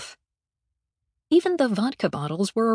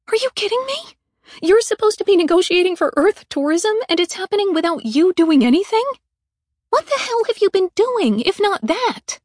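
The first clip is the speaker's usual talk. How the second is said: much higher and louder, fast; incredulous fury; 9 semitones higher, 7 dB louder than usual. Acted. Mallory berating the ambassador for doing nothing, incredulous fury. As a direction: tear into him in disbelief.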